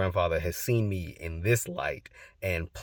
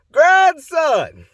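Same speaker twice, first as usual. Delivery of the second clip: big booming call, pitched way up; grandpa impression; 16 dB louder than usual. Grandpa greeting his grandson on the phone, loud imitated holler. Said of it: "y'all know exactly how he talks".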